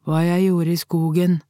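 lightly voiced boy, level tune; a hint of a child, little melody; boy's answer. A narrator's reading. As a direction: answer plainly as a reluctant child. Nervous boy answering questions.

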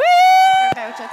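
high, drawn-out presenter call; grand announcement. Acted. Host presenting a voucher, high drawn-out fanfare. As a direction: hand over the prize with a big fanfare.

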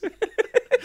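high squeal laugh. Real, not acted. Laughs at comment.

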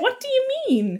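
high, incredulous mock question; mock disbelief. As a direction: act baffled by his question. Quoting her own disbelief.

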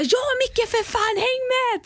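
high, excited come-on; eager urging. Retold or imitated. Telling Micke to come see the crab, high and eager. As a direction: hurry him along, thrilled. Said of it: "Och vi bara".

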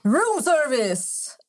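high, very slow, sing-out announcement; imitated man at door. Man with champagne at the hotel door, imitated playful announcement. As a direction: draw it out playfully like fake room service. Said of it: "Han bara".

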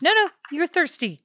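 high, insistent mock voice; imitating bossy leader. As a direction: lecture her in a high put-on voice. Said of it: "She's like".

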